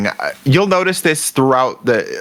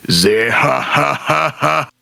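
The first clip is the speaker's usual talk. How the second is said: staccato "zeh-ha-ha-ha" character laugh, climbing higher; imitated villain laugh; 5 semitones higher, slower than usual. Fan demonstrates one character's signature laugh, measured staccato mock laughing. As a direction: put on a signature anime laugh, each ha separate. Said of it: "give you one of the laughs".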